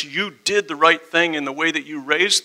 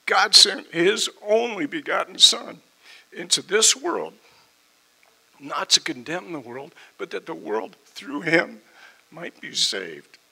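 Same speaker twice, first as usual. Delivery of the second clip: emotional; 3 semitones higher, 3 dB louder than usual. Real, not acted. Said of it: "It's gonna get emotional like this".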